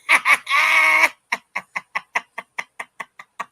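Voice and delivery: loud screaming laugh that nearly turns to crying; shrieking laughter. Real, not acted. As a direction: burst out in a long howling laugh. Cracks up at the comment.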